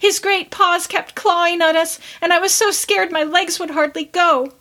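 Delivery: high little piping voice; small girl hiding in skirts, piping high; piping child. A narrator's reading. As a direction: pipe it out like a scared small child. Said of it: "piped out".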